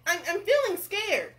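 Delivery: high, stammering mock fear; scared cook. Cook confesses fear to the king, acted fright. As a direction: falter in storybook fright.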